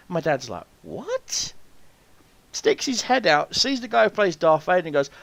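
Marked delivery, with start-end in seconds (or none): 0.8-1.5 high, soft disbelieving yelp; retold high-pitched disbelief; incredulous. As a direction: imitate a baffled dad, pitch shooting up. Dad's disbelief on hearing Darth Vader.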